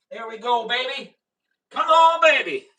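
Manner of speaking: loud, high coaxing cheer; excited urging. Real, not acted. Host urging on his test setup, excited urging.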